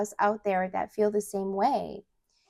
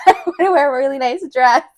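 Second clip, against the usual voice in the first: giddy; 6 semitones higher, 13 dB louder, much faster than usual. Retold or imitated. Excited retelling, laughing.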